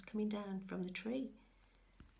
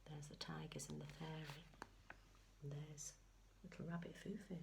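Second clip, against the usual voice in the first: whisper; 3 semitones lower, 11 dB quieter than usual. Real, not acted. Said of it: "whispered aside".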